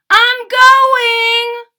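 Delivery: sung-out loud call. Acted. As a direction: sing it out high as he runs off. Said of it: "called Franklin".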